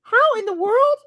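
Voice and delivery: slow, high disbelieving echo; stunned, drawn-out question; incredulous. Real, not acted. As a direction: repeat it in disbelief. Incredulous repeat of odd fact.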